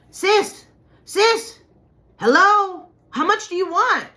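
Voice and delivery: high, loud calling out over and over; retold yelling to snap her out of a daze; yelling drug dealer. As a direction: shout to get her attention. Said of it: "he's yelling at me".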